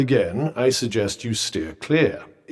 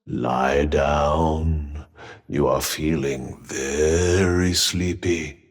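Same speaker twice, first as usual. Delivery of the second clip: low, slow, droning hypnotist voice; mock hypnotist; 11 semitones lower, much slower than usual. Telling a tense companion to rest, mock hypnotist. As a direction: lull them to sleep in a deep, drawn-out drone.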